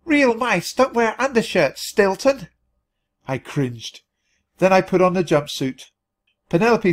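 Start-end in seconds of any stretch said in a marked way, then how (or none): 0.0-2.5 squeaking at full lungs. A narrator's reading. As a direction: squeak it out at top volume. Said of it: "squeaked at the top of her lungs".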